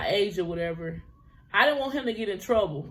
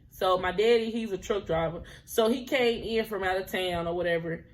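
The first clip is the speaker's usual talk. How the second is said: ordinary delivery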